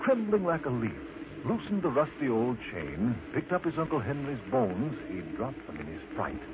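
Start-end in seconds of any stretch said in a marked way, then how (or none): none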